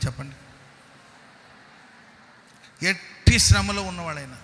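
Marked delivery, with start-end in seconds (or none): 2.9-4.4 louder repeat. Shown as a demonstration.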